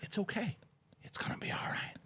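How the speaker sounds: soft, sinking into a whisper; mock soothing. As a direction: re-enact whispering comfort to an angry stranger. Soothing the screaming woman.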